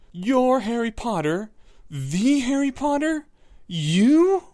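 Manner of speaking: high, loud, slow disbelief; shocked Neville. A narrator's reading. Neville staring at Harry, loud high disbelief. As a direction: gasp it out in awe.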